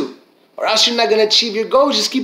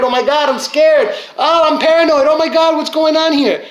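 higher-pitched mock panic; acting scared; 7 semitones higher than usual. Motivational speaker mimicking someone hooked on negative news, scared. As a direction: play up paranoid alarm. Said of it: "Now you're becoming scared".

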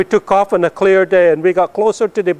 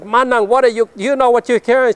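high retold holler across the airstrip; 8 semitones higher, 3 dB louder than usual. Calling to his oldest sister carrying the box away, retold holler. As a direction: call out to her, pitched up in alarm. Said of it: "And I hollered at her".

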